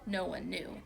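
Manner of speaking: ordinary delivery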